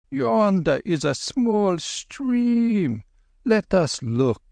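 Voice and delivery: put-on high, slow gentle old monk; old lama voice.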